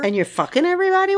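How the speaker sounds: mock outrage. Mocking outrage at the character.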